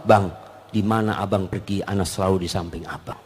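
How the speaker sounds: soft, low loving promise; wife softly reassuring her husband on the way out; tender wife. Acted. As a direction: murmur it sweetly to your husband.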